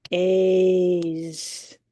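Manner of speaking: Phase stretched into one long sound; drawn-out sound. Shown as a demonstration. Teacher stretching the word for the class. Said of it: "I'm gonna pound the sounds".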